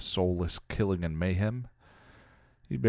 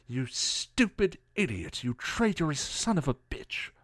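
seething accusation at a raised pitch; angry accusation; 8 semitones higher, 3 dB quieter than usual. A narrator's reading. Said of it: "Giles accused".

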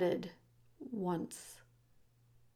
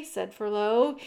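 ordinary delivery, nothing marked

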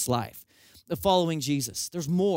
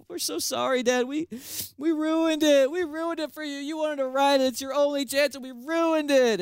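much higher, imitated tearful children; crying kids' apology; 8 semitones higher than usual. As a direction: plead sorry like sniffling little kids. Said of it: "the kids are crying".

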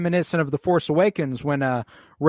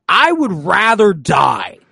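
louder, slow and drawn-out mock vow; dramatic defiance; 6 semitones higher, 8 dB louder, slower than usual. Retelling Leia refusing her mother, slow, loud, hammed-up. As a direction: ham up a teenage refusal. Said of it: "Leia said".

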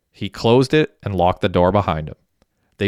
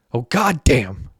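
higher-pitched mock yell; yelled exclamation; 4 semitones higher, slower than usual. A narrator's reading. Hecky reacting to Arlov's money news, a mild yell. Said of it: "yelled Hecky".

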